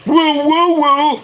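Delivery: loud high woo like a wrestler; woo call. Acted. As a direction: belt out the woo to the crowd.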